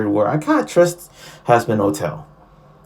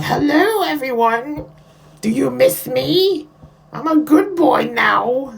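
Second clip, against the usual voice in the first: very high-pitched, drawn-out character greeting; Serpentuous; 13 semitones higher than usual. Acted. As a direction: sing it out high, playing the returning goody-goody. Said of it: "Serpentuous showing up and like".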